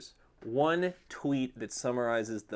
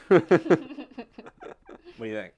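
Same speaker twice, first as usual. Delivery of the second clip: ordinary delivery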